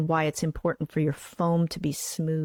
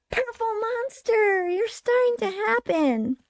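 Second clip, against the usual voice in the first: very high, drawn-out puppet squeal; high falsetto monster, savoring each word; 3 dB louder, slower than usual. Acted. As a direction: stretch it out slowly in a high-pitched puppet voice. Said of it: "should this be my voice?".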